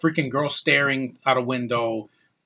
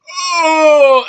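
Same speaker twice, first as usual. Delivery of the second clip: a sudden yelp, much higher and louder; mock pained cry; 14 semitones higher, 11 dB louder than usual. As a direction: yelp as if the punch hurt her hand. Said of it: "she's like".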